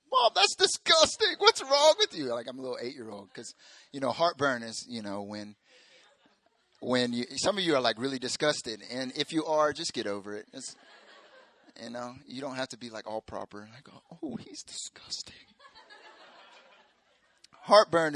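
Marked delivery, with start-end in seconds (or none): 0.1-2.3 put-on disgusted kid voice. Boy yelling at mom in the car, high disgusted squeal. 14.2-15.3 mock prim whisper. Prim listener mocked.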